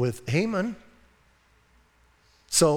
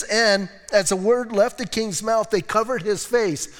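sing-song reading; 4 semitones higher than usual. Real, not acted. Scripture read in lilt.

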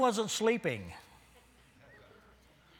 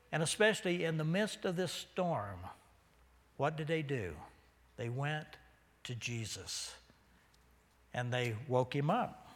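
ordinary talk, nothing marked

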